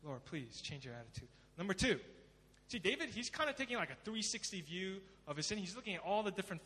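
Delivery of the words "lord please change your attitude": soft, low prayer; hushed plea. Acted. Sinner asks God to change attitude, hushed plea. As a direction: drop low and plead quietly.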